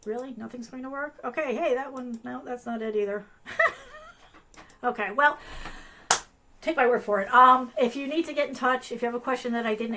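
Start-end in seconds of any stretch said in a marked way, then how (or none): 3.4-4.1 short laugh. Real, not acted. Embarrassed at tech failures.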